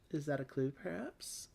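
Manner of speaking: ordinary talk, nothing marked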